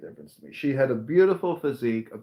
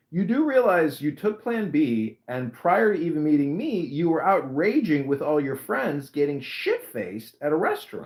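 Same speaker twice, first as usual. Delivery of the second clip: exasperated retort; 5 semitones higher than usual. Retold or imitated. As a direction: argue your case, pitched up and stressed. Retold argument with the ex, exasperated.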